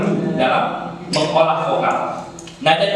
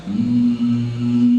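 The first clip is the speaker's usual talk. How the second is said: long, low steady hum; humming; 6 semitones lower than usual. Shown as a demonstration. Trainer shows morning breath exercise, humming. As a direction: hum one sustained low note. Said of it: "bergumam misalnya".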